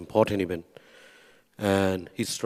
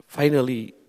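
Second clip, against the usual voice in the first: usual speaking voice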